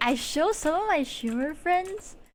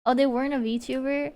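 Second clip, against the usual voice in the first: ordinary talk, nothing marked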